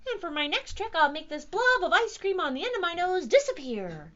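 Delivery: high, loud announcement; showy magician cat. A narrator's reading. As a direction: proclaim the trick, building to the last word. Cat announces next trick like magician.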